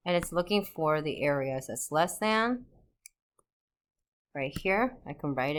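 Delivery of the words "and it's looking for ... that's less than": ordinary talk, nothing marked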